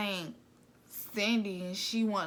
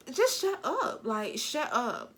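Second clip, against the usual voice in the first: sharp high snap on the first, then a flat dismissive repeat; annoyed shut up; 4 semitones higher, 3 dB louder, slower than usual. Retold or imitated. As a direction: cut her off, fed up. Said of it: "Seven was just like".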